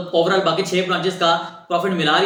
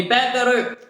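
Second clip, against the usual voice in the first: higher, called across the shop; calling out; 6 semitones higher, much slower than usual. Retold or imitated. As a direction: call the order to the packer. Salesman calls to packer.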